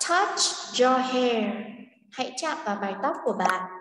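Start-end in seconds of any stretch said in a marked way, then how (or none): 0.1-1.5 slow. Shown as a demonstration. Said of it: "echoes the recording".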